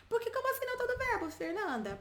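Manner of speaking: high, quick student protest; baffled student. Acted. Voices a baffled student's objection.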